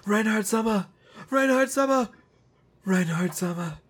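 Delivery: high-pitched frantic calls, held back in volume; mock yelling. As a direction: imitate someone yelling a name over and over. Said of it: "he's just yelling".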